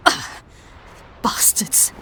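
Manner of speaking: breathy whispered insult, barely audible; whispered curse. Acted. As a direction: spit the word out in a whisper. Learning body dumped in pit.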